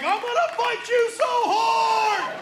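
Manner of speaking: put-on high, slow, shouting teen; angry teen shout. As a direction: yell it up high like a furious teen. Son's furious shout.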